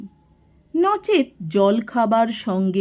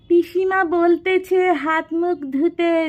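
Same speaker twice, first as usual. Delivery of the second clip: put-on timid, higher-pitched boy's voice; hesitant; 8 semitones higher than usual. A narrator's reading. Fatik timidly passes on his aunt's message, hesitant. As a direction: relay the message sheepishly. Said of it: "সসংকোচে বলল".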